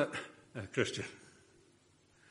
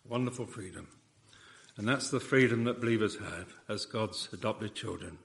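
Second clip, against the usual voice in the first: ordinary talk, nothing marked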